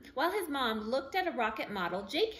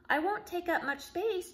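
put-on higher eager child voice; lightly voiced little boy; 8 semitones higher than usual. Jake pleads with the official, higher eager kid voice. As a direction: sound like a keen little boy.

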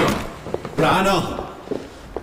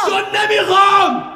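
loud, high, slow furious outburst; furious shout; 8 semitones higher, 5 dB louder, slower than usual. Acted. Husband yelling at his wife that he forbids it, furious. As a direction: hammer out the refusal.

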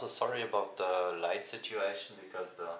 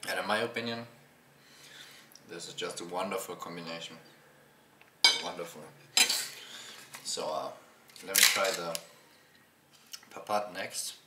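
eating between words; talking while chewing. Real, not acted. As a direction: mouth partly full of food. Seen 3: Spoon to mouth just before; jaw moves between words while eating.

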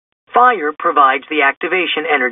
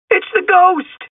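very high cartoon cry of fright; high-pitched, scared outburst; alarmed exclamation. A narrator's reading. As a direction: exclaim it in a squealing panic. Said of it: "he exclaimed".

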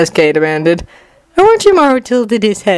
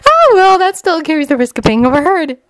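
put-on high-pitched girl reconsidering; Sakura musing to herself; 9 semitones higher than usual. A narrator's reading. Sakura goes on to herself, high musing voice. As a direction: think aloud in a high voice.

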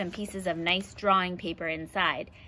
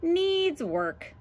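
unimpressed lion; 8 semitones higher than usual. Acted. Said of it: "said the lion".

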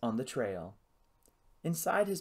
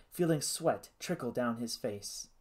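usual speaking voice

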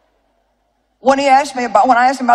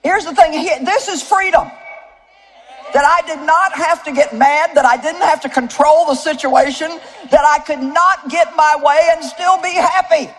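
raised, fervent preaching, slower in places; preaching at full force; 6 semitones higher than usual. Acted. Preacher proclaims God's freedom to the congregation, fervent and raised. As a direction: lift the room with conviction.

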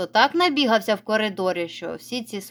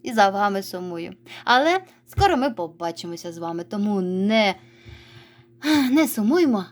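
ordinary talk

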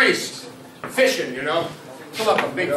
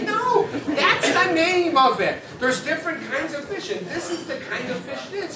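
insistent priest; 8 semitones higher than usual. Acted. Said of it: "they say".

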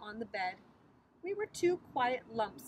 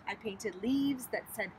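plain reading voice

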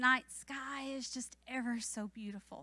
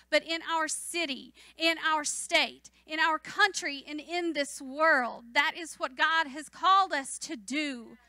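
building, louder sermon cadence; fervent preaching; 3 semitones higher, 6 dB louder than usual. Acted. Preacher sending the congregation out as witnesses, fervent preaching. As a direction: call the church to mission.

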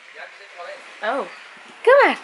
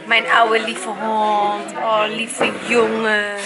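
tender cooing; 3 semitones lower, much slower than usual. Real, not acted. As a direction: draw it out lovingly. Tender to old dog.

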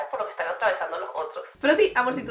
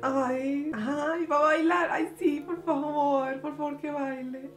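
hopeful plea; 4 semitones higher, slower than usual. Real, not acted. Hoping the girl dances.

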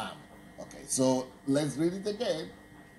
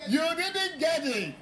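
very high, laughing shriek of triumph; real delighted cackle, pitched way up; gleeful laughing gotcha; 12 semitones higher than usual. Real, not acted. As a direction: crow it through big peals of laughter. Teacher gleefully springs trick on class.

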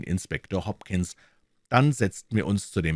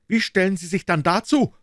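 loud, much higher accusing shout; shouting at prisoner; 12 semitones higher, 7 dB louder than usual. A narrator's reading. Inspector confronting the prisoner with the notebook, loud accusing shout. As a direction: yell it in his face. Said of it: "schrie Hopkins unseren Gefangenen an".

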